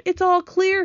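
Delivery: high, carrying all-clear call. A narrator's reading. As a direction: call back to the others. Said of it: "he called".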